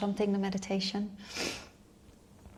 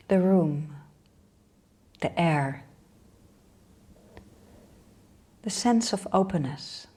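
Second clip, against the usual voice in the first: unhurried meditation guide with long pauses; guided meditation. Real, not acted. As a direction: lead them inward in short, spaced-out phrases.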